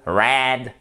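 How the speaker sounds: "Red" with a nasal American R and vowel. Shown as a demonstration. Teacher demonstrates, "Red" very nasal. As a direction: send "Red" through the nose, American style. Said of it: "avec le nez très nasal".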